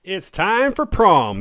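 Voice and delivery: loud, high, drawn-out proclamation; hyped announcement. Pretend. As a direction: belt it out like a big reveal. Hyped announcement of the prom theme.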